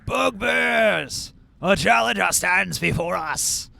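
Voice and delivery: booming sergeant addressing the troops; bugbear sergeant voice. Acted. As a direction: proclaim it like a war leader. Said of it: "And he says".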